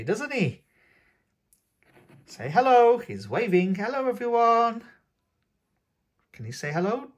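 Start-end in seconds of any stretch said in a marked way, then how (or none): 2.3-4.8 excited greeting. Real, not acted. Unboxer waves the toy at young viewers, loud and high.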